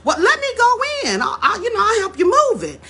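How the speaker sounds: very high, quick helpful offer; an eager young man jumping in to help. Retold or imitated. Jim offers the grandfather help, very high and eager. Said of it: "young man says".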